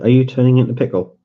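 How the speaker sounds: usual speaking voice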